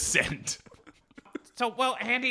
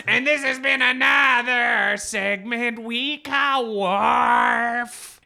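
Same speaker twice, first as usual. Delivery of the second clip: announcer voice; 8 semitones higher, 6 dB louder, much slower than usual. Pretend. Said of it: "announcer sign-off".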